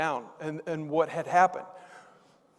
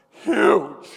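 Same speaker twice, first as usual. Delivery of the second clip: mock-grave emphatic exclamation, higher and louder; concerned; 4 semitones higher, 10 dB louder than usual. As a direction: imitate a worried assistant stressing the scale of trouble. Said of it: "She looks very concerned".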